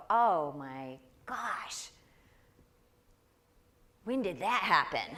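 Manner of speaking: slow, loud, laughing mock gasp at the mirror; mock shock at the mirror. Woman laughing at her reflection, mock-shocked and loud. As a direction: act caught off guard by your own reflection. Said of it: "look in the mirror and go".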